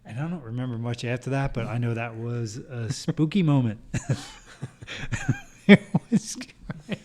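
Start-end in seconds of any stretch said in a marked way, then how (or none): 3.9-7.0 high, slow words squeezed through real laughter; laughing. Real, not acted. Friend recalling the stuck elevator to the hosts, laughing. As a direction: barely get it out for laughing.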